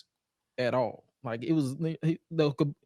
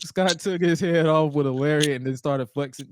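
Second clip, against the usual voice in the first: usual speaking voice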